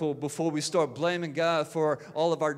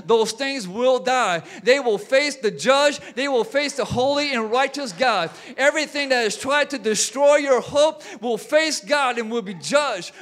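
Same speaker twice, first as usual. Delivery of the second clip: louder and higher, rising to a shout; full-force revival preaching; 4 semitones higher, 4 dB louder than usual. Acted. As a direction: build the crowd up, then land it. Declaring the Herods must die.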